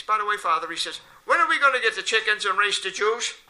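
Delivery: put-on eager little kid. Kid asks priest in joke.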